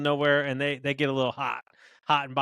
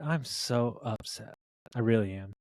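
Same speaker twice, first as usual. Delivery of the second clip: slow, low feigned upset; mock upset; 6 semitones lower, 3 dB quieter, slower than usual. Owner pretends to side with the older lady, mock upset. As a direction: play solemnly upset, sinking low.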